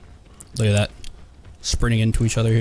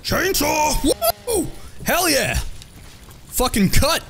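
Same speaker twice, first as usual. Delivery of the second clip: high-pitched victory cries; excited whooping; slower than usual. Real, not acted. Gamer celebrating a chainsaw kill, excited whooping. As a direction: whoop and crow over the kill.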